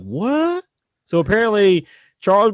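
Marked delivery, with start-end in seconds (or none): 0.0-0.6 high squeaked what; shocked high-pitched what; baffled. Retold or imitated. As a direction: squeak it in disbelief. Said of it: "And you're like".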